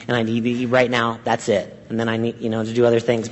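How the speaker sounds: low, quiet voice for a simple animal; lightly voiced animal. Preacher voicing what animals think, lightly acted animal. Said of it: "They're like".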